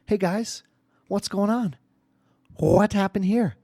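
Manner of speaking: high little-girl voice, imitated; dad mimicking his daughter's high questions; Isabella. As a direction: pitch it up like a curious daughter. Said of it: "My daughter, Isabella, comes down the stairs".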